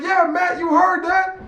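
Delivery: high mock gloat; fan gloating. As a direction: crow at Matt in a high put-on voice. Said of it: "He was like".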